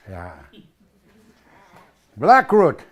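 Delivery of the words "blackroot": announcing. Real, not acted. Announces next plant name.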